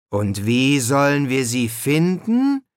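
slow, doubting question rising at the end; skeptical. A narrator's reading. Brian doubts they can find them, skeptical. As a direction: draw out the question with mild scepticism. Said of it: "fragte Brian skeptisch".

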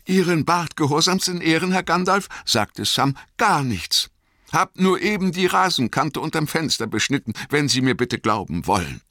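high, quick and humble servant voice; eager, deferential young gardener; high-pitched and hurried, bowing and scraping; Sam the gardener. Acted.

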